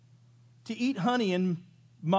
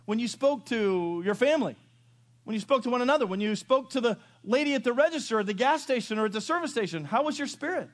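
raised, louder preaching voice, driving each line; preaching at full force; 8 semitones higher, 5 dB louder than usual. Acted. Preacher challenging his congregation, louder and higher. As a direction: push the question home from the pulpit.

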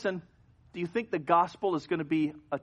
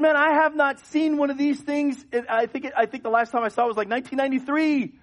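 excited chatter; 10 semitones higher, 7 dB louder than usual. Retold or imitated. Thrilled at seeing old car.

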